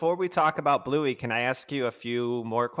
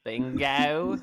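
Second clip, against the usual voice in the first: put-on Australian accent. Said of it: "I like your Australian accent".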